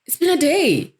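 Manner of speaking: making noise. Retold or imitated. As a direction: call out high to get attention. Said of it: "I want to make the most noise I can".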